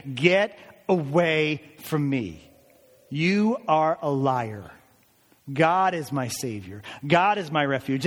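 slow, deliberate preacher's rebuke; measured, emphatic statement of faith; defiant declaration. Acted. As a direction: rebuke the tempter word by word. Models defying doubt and Satan.

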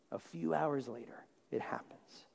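hushed whisper for the betrayal. Real, not acted. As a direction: drop to a breath to let it land. Quiet dramatic turn in sermon.

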